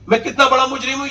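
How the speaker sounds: despairing outcry before collapsing; anguished cry. Retold or imitated. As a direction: wail it as your heart breaks. Dying man crying out guilt.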